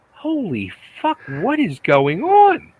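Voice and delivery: high-pitched, drawn-out astonished reaction; stunned disbelief. Retold or imitated. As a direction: relive watching it, floored, stretching the words. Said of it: "my mouth open".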